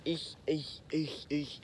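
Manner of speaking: soft squeaky "eek" calls; mock Cajun squeal on "eek"; imitated squeal call. Shown as a demonstration. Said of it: "but it'd sound like".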